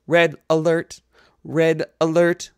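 high, loud alert call; shouted alarm. A narrator's reading. Ship's computer warning all hands, high shouting. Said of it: "the ship was shouting".

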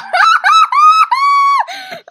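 very high, loud shrieking laugh; delighted laughing shriek. Real, not acted. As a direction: squeal with laughter at the top of your lungs. Wild ride peak.

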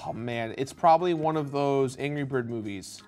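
usual speaking voice

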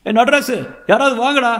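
high, loud sustained yell; desperate call for help. A narrator's reading. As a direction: shout for your friend to save you. Choking man calls friend for help.

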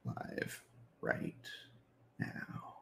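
very soft, slow, halting murmur; mumbling while typing. Real, not acted. Streamer typing a post about going live, mumbling to self. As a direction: sound out words as you type them.

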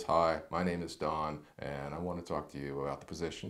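bored monotone. Shown as a demonstration. Coach showing a bad phone introduction, bored drone. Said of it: "don't just show up and talk like this".

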